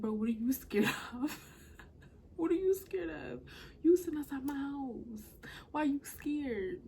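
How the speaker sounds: high, loud, laughing disbelief; retold outburst of disbelief; incredulous challenge. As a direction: demand an answer, baffled and loud.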